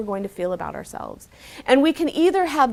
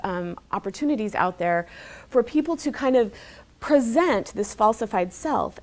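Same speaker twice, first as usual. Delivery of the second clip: usual speaking voice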